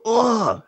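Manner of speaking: high-pitched "ugh" of pain; imitated pained groan. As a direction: groan as if getting crushed. Imitates buddy being crushed.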